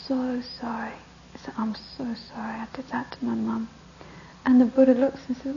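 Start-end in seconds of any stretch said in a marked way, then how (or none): 0.1-3.7 soft, contrite whisper; hushed remorseful plea; whispered apology. Acted. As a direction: confess in a hush. Son apologises to the Buddha.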